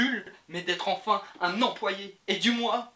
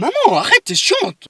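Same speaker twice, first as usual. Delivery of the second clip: high, loud, exasperated yelling; annoyed yelling; 10 semitones higher, 13 dB louder than usual. Acted. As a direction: yell back at mum. Son yelling back at mother.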